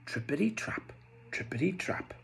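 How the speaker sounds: soft whispered trippity trap; whispered hoofsteps. Acted. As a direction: tiptoe it in a whisper.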